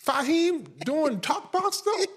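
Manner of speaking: can't believe what he's hearing; incredulous. Retold or imitated. Host retelling his reaction to the producer, high and amazed. Retold disbelief about Fahim.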